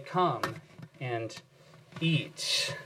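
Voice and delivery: soft, hushed welcome to the table; gentle invitation. Pretend. Pastor voicing the church's welcome to children, soft and hushed. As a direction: softly invite the little ones in.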